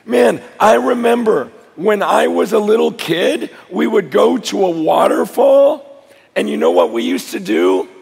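loud, high preaching at full force. Acted. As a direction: preach it out to the crowd.

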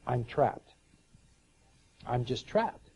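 low, subdued retold thought; retold trapped feeling. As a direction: voice her trapped thought quietly, dropping low. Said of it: "she got this feeling".

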